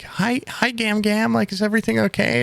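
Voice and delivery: very high, cooing imitation of relatives; sweet talk to Grandma. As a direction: coo at Gam Gam in a put-on high voice. Imitating people greeting an elderly grandmother.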